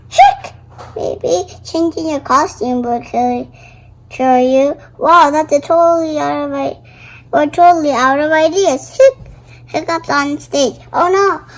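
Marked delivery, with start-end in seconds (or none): 0.1-0.5 gasp, then a high rising hiccup. Acted. Girl panicking as the play starts, gasping hiccup. 9.0-9.3 one high loud hiccup; squeaky hiccup. Acted. Girl hiccuping while friends think, high squeak.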